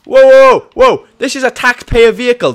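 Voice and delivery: high, loud indignant shouting; shouting in mock outrage; outraged yell. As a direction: yell at them like an offended cop. Cop yelling at car thieves.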